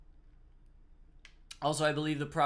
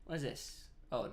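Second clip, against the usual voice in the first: ordinary talk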